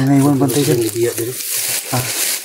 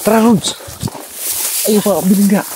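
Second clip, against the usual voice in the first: while running; 9 semitones higher than usual. Real, not acted. Running after the group.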